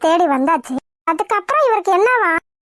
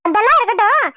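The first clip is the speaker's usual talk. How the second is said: usual speaking voice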